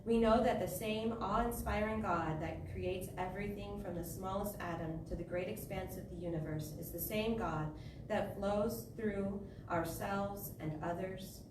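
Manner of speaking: normal talking voice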